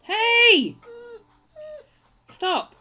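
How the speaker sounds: loud, high sudden shout; sharp shout. Real, not acted. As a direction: yell to stop it at once. Sudden call to stop something.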